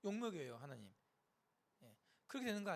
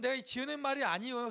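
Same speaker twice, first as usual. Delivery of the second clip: ordinary talk, nothing marked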